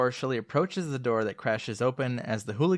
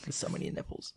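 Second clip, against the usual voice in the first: much softer, whispered aside; 10 dB quieter than usual. Real, not acted. Hushed joking aside about Batman movies.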